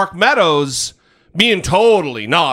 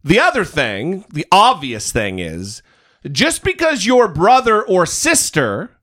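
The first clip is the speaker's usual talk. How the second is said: usual speaking voice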